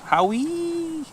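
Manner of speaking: high, drawn out Howie like a howl; howling dog sound. Shown as a demonstration. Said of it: "Howie is actually closer to".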